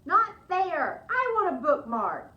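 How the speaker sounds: put-on loud, whiny kids' protest; children complaining. The children loudly complain to Missus Page, whining. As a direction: chant it like a crowd of sulky children. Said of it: "They're all saying".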